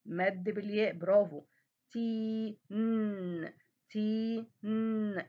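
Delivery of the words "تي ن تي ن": "تي" held long, then "ن" hummed separately; level segmented demo, "تي" then a sustained "ن"; stopping on the noon. Shown as a demonstration. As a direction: sound out "تي" and "ن" apart on a steady note. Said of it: "وقفت عند صوت حرف النون".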